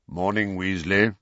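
casual, offhand greeting; understated nonchalance; careless greeting. A narrator's reading. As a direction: toss it off carelessly. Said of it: "said Kingsley carelessly".

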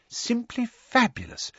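high, slow, hushed marvelling; awed whisper. A narrator's reading. Mr Weasley enthralled by Muggle ticket machines, whispering in awe. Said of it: "he whispered".

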